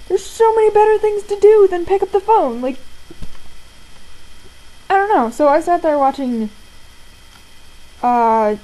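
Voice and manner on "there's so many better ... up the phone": high, loud indignant complaint; retold exasperation. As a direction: protest it, fed up and louder. Exasperated retort about ignored calls.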